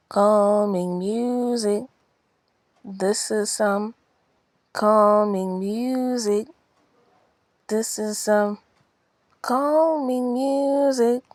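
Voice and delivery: loud, slow mock announcement; mock announcer. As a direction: announce it like a joke ad. Announcing calming music, mock.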